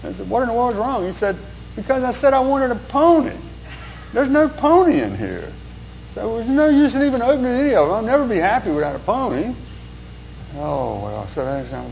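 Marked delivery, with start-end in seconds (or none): 0.3-1.0 high, puzzled what's-wrong; baffled asking. Retold or imitated. Parent to the sobbing boy, high and baffled. 1.7-9.5 put-on high, sulky child's complaint; whiny little boy. Pessimist boy to his parent, high and whiny.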